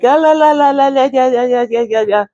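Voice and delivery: put-on playful high-pitched baby gibberish; baby babble. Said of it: "the little baby and they're like".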